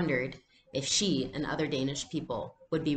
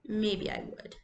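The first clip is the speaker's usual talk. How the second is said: put-on softer, reluctant child; grudging; 5 semitones higher, 5 dB quieter than usual. Little girl to her friend, grudgingly quiet. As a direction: give in grudgingly. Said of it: "she said finally".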